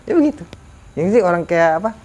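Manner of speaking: normal talking voice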